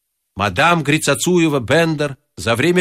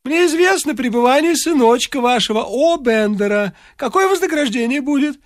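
put-on wheedling old man; 11 semitones higher than usual. A narrator's reading. Varfolomeich bargains.

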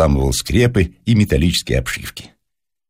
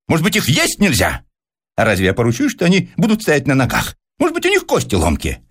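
high-pitched, mounting agitated rant; carried away; 12 semitones higher than usual. A narrator's reading. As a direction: pile up objections, heated and high. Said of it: "Персиков вошёл в азарт". (в азарте, взволнованно, высоким голосом)

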